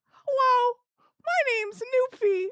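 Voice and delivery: high-pitched little character voice; Newfie. Acted. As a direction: introduce yourself in a high, put-on puppet voice. Voices the character introducing himself.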